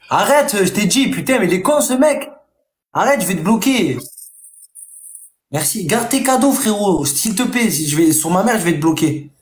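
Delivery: real exasperation. Real, not acted. Streamer threatening to block a viewer over gifts, exasperated. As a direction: plead and threaten, at the end of patience.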